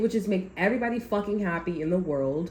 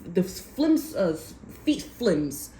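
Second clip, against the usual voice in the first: ordinary talk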